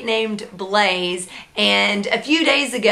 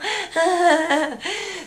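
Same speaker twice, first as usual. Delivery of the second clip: a high burst of laughter; 8 semitones higher than usual. Real, not acted. Mom laughing at her own story, high laugh. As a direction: laugh at the memory.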